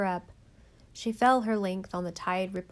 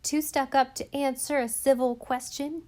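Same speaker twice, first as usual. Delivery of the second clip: higher, mocking sneer; sneering girl; 5 semitones higher, 3 dB louder than usual. A narrator's reading. Harbor girl mocking Nan as stuck up, sneering. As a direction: sneer it down at her. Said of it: "yelled a girl".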